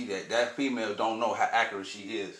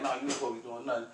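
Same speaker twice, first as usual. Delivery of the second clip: insistent quick; 3 semitones higher, 4 dB quieter, much faster than usual. Retold or imitated. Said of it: "She like".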